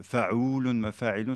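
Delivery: slow, syllable-by-syllable meter chant; fa'oulun, mafa'ilun chanted slowly like a drumbeat; scanning the meter. Shown as a demonstration. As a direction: beat out each long and short syllable of fa'oulun mafa'ilun. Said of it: "ça, c'est le bahg tawil".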